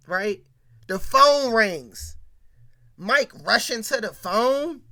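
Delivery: ordinary talk, nothing marked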